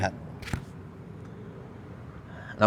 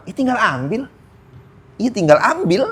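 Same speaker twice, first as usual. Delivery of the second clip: glib brush-off; 8 semitones higher than usual. Retold or imitated. Mock dismissive reply.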